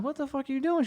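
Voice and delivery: high, hushed disbelief, retold; bewildered question. Man startled by her witch chant, bewildered.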